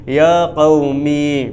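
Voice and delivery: normal talking voice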